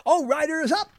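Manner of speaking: high-pitched racetrack call; mock jockey call. Radio host acting out jockey lingo, high mock call. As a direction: call it out like the start of a race.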